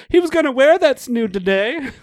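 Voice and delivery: high, loud hammed-up society lady; period-drama lady parody; mock period-drama lady. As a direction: fuss like a prim aristocrat. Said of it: "the girl goes".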